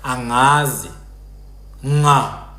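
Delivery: "angazi" and "nga" with ng as in singer; Hlubi soft ng. Shown as a demonstration. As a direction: say "nga" with no hard g. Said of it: "a Hlubi person will say".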